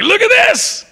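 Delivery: high squeal of delight at a catch; proud excitement. Retold or imitated. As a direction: show off the fish, thrilled. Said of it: "you're like".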